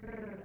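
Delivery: R rolled with several tongue trills; rolled r exercise. Shown as a demonstration. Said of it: "it sounds like this".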